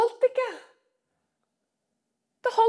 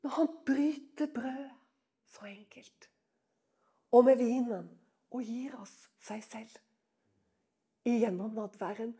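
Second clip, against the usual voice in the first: soft hush; 7 semitones lower, 8 dB quieter than usual. Real, not acted. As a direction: lower and much softer, nearly whispered. Soft ending on communion. (stille og inderlig)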